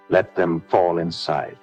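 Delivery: ordinary talk, nothing marked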